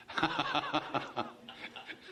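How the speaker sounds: long breathy run of chuckles; amused rolling laughter; hearty laugh. Real, not acted. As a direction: burst into a drawn-out ha-ha-ha laugh. Laughs at his own joke.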